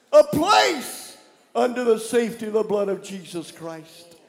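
loud, high fervent prayer; shouted pleading prayer; preaching at full force. Real, not acted. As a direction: pray it out like a revival. Leads congregation in prayer.

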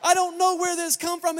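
imitating a mother fed up with her kids; worked-up mom. The mother scolds her rowdy pastor's kids, exasperated and shouting. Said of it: "get my mom all worked up".

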